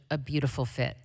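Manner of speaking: normal talking voice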